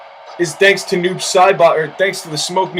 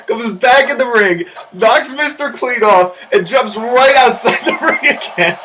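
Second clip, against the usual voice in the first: wrestling commentator; 4 semitones higher than usual. Real, not acted. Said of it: "wrestling commentary through laughter".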